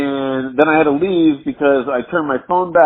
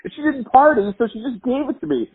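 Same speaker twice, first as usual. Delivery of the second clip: much higher, laughing, tumbling-out explanation; giddy excited retelling; 11 semitones higher than usual. Retold or imitated. Explaining to the girl where the eight ball came from, giddy and much higher. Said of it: "I'm like".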